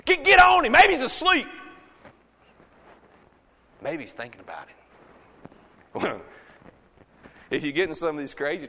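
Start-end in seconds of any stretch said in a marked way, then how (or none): 0.0-1.5 loud, high taunting shout; mocking holler. Elijah mocks the prophets of Baal, mocking holler. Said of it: "holler at him a little louder". 3.8-6.2 soft, low sly aside; mock musing. Elijah teases the prophets about their god, mock musing.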